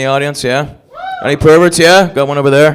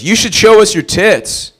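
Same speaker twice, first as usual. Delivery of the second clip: slow, raised catcall; loud mock heckle, higher pitched; yelling out; 8 semitones higher, 5 dB louder, much slower than usual. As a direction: holler it crudely across a party, drawn out. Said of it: "he just yells out".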